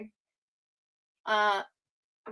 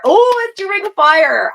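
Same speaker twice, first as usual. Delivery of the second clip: excited [name]; 11 semitones higher, 12 dB louder than usual. Real, not acted.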